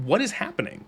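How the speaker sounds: softly incredulous, as if lost; mock bewilderment. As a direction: drop the volume and let the pitch climb, baffled. Acting out confusion at mixed accents.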